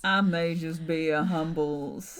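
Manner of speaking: loud Southern gentleman drawl; Southern lawyer voice. Acted. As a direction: play a humble Southern lawyer. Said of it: "humble city lawyer".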